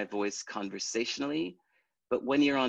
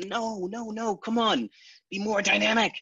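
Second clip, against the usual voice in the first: higher, louder coaxing; imitating the boss; 11 semitones higher, 4 dB louder than usual. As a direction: push him to give more. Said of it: "the boss would go".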